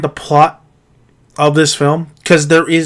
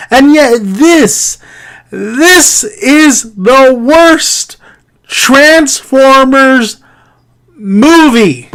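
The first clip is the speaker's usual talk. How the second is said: "worst Transformers movie" high, very slow, word by word; slow, high, incredulous stress on "worst Transformers movie"; exasperated emphasis; 6 semitones higher, much slower than usual. Real, not acted. Exasperated verdict, spelled out slowly.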